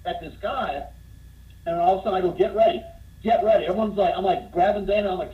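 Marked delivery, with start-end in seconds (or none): 2.3-3.6 louder, higher, excited heads-up; rally your friends before it kicks off; urgent warning. Retold or imitated. Man alerting his friends to a coming fight, urgent and excited.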